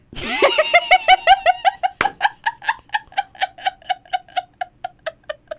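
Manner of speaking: long, high burst of laughter at own joke. Real, not acted. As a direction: crack up hard, high and long.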